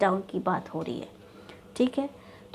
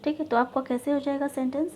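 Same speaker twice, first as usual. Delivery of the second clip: normal talking voice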